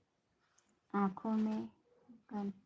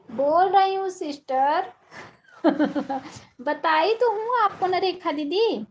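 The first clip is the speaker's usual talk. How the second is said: raised, high-pitched voice; speaking up loudly; 7 semitones higher, 5 dB louder than usual. Real, not acted. Sister asked to talk louder as she can't be heard, speaking up loudly. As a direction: project so you can be heard. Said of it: "Hanuman जोर जोर बोलो".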